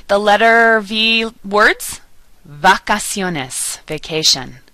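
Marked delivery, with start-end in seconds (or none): 2.5-3.8 slow "vacaciones", V softened toward B; Spanish "vacaciones", as "bah-kah-SYOH-nes"; Spanish soft V. Shown as a demonstration. Teacher modelling V words, soft V in "vacaciones". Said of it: "V sounds more like the letter B".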